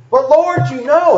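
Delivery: high-pitched pleading protest; insist, pitched well up; acted exasperated plea; protesting Peter. Voicing Peter protesting again.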